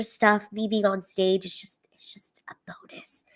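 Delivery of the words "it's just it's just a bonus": whispered through tears; breathy near-silent aside; tearful whisper. Real, not acted. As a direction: let it drop to a whisper. Emotional aside while tearing up.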